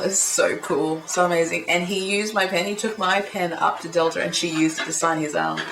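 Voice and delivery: ordinary talk, nothing marked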